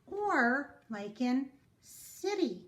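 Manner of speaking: "city" said slowly with a clear S; soft S sound. Shown as a demonstration. Teacher demonstrating C before I in "city". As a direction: show the S in "city". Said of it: "makes the sound of S".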